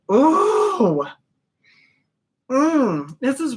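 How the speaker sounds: choked-up gasp of emotion; emotional cry. Real, not acted. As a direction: overcome by the scent. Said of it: "now I'm getting all emotional".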